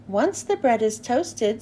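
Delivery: ordinary delivery, nothing marked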